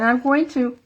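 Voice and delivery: speaking through sudden hiccups; words jolted by a hiccup. Real, not acted. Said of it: "I got the hiccups all of a sudden".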